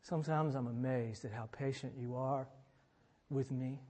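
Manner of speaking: soft, low, unhurried prayer voice; hushed heartfelt pastoral prayer; closing prayer. Real, not acted. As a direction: pray quietly over the congregation.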